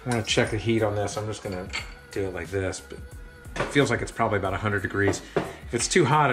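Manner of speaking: normal talking voice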